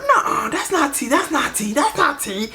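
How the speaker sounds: high, repeated insistence; disbelieving denial. Retold or imitated. On the phone about the tagged man, disbelieving denial. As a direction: refuse to believe it, pitched well up. Voicing denial of the shocking news.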